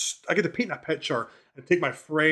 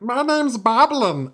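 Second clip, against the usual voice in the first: high, drawn-out little goblin voice; Boblin, toddler goblin; 6 semitones higher, 3 dB louder, much slower than usual. Acted. Toddler goblin telling the party his name, in a high, childish creature voice. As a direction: squeak it slowly like a tiny goblin child. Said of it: "goblin who was three years old".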